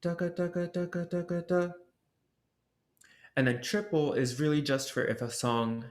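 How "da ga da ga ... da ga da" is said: alternating "da, ga" on one high pitch; softer, high monotone "da, ga" syllables; double-tonguing demo. Shown as a demonstration. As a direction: rattle "da, ga" evenly between tongue tip and back. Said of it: "you'll alternate".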